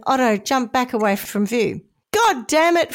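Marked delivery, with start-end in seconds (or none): 2.1-2.9 mild acted yell, higher pitched; half-hearted storyteller's yell; angry yell. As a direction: exclaim crossly, voice raised only a little. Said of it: "Fred yelled as he raced".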